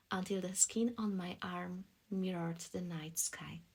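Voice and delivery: plain reading voice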